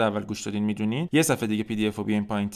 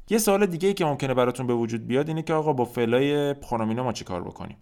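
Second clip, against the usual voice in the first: usual speaking voice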